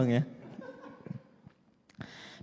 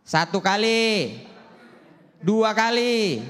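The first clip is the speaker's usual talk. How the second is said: ordinary talk, nothing marked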